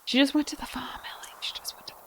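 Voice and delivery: hushed, voiceless whisper; whispered consolation. Pretend. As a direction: whisper it like a comforting fib. Whispered consolation after the loss.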